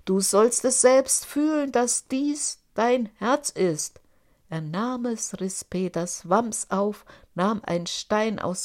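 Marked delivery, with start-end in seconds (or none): 0.1-4.0 menacing giant. A narrator's reading. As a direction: drive each word home. Giant's threat.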